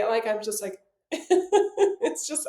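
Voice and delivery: usual speaking voice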